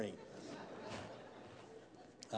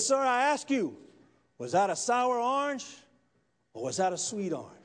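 raised-voice demand, pitch lifting on sour orange; insistent questioning; 10 semitones higher, 5 dB louder than usual. Acted. Preacher repeats his question to a silent atheist, insistent. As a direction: press the question, louder and higher.

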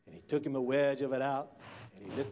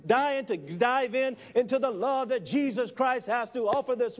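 loud, high, driving sermon voice; full-force preaching; 9 semitones higher, 5 dB louder than usual. Real, not acted. Preacher likens a taste of chili to Jesus, impassioned. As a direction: preach it hard, pitch raised throughout.

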